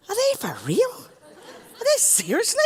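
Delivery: can't believe what you're hearing; incredulous. Retold or imitated. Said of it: "and I went".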